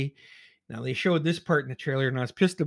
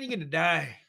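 ordinary talk

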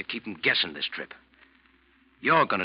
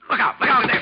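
loud, high warning yell; sharp warning; 12 semitones higher, 7 dB louder than usual. Acted. First mate shouting a warning at the stumbling captain. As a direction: yell to stop a collision.

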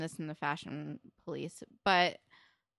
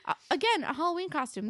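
incredulous outrage; 11 semitones higher than usual. Real, not acted. Ranting about the feather boa.

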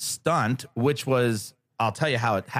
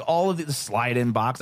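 ordinary talk, nothing marked

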